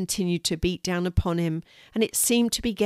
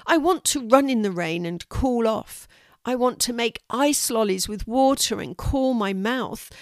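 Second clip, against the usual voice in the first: higher, insistent wanting; irritable demand; 5 semitones higher than usual. A narrator's reading. Cranky boy to Molly, insistent whiny demands. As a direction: list each want with growing impatience. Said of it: "Louis snapped".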